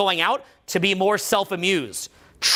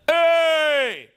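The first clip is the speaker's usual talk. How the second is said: high, drawn-out resonant hey; relaxed booming call; 7 semitones higher, 4 dB louder than usual. Shown as a demonstration. Coach showing the room a relaxed voice, booming. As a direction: boom it out without strain. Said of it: "notice that I'm just, rela".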